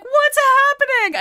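high, slow panicked squeak; a stunned girl not knowing what's going on; high-pitched bewildered squeal. Retold or imitated. Panicked girl voiced in story.

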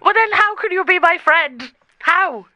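high, loud challenge; indignant protest. Acted. AI confronting rival who wants friendship, indignant. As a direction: raise pitch and volume in mock outrage.

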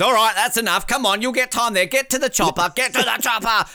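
loud, high mock order to move along; hammed-up pushy host barking get to the chopper; impatient Dicko. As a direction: shoo them off like an impatient host. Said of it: "within two seconds".

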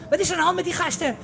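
high, fast, rough driver voice; gruff taxi driver. Retold or imitated. Taxi driver asks what is wrong with the boys, rough and fast. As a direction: bark it like an annoyed cabbie. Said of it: "Die taxichauffeurs zijn meteen".

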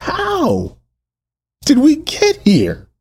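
high, anguished question with a long pause; burdened, emotional plea. Real, not acted. Preacher burdened by the text, emotional cry. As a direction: let the question break out high. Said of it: "I'm so burdened".

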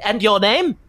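high, bright-eyed tinkerer greeting strangers; eager inventor voice. Acted. Game master voicing the inventor Amadi meeting the party, high and eager. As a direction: lift it way up and gush at the newcomers.

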